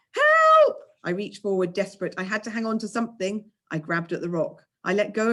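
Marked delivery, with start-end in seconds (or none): 0.1-0.8 high, loud panicked yell; a frightened boy shouting for help; scared cry for help. A narrator's reading. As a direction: scream for help, terrified. Narrator dangling in midair.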